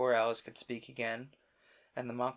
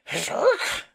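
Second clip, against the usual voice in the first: high, loud squawk; sharp exclamation; 12 dB louder than usual. Acted. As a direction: burst out with a squawk. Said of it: "an occasional exclamation".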